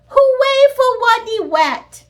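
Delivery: very high loud slow cheer. Acted. Students cheering their hero, very high and loud. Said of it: "they cried".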